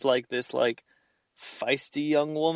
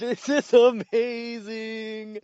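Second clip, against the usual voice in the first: laughing delight; 10 semitones higher, much slower than usual. Real, not acted. Delighted at the bit.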